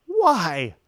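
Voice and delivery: mock disbelief, lifting on the why; shocked. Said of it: "were shocked by this".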